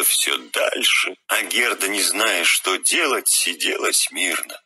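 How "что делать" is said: plain reading voice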